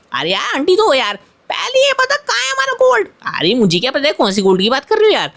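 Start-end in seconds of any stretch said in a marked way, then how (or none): 1.5-3.1 demanding aunty voice. Acted. Aunty demands her gold.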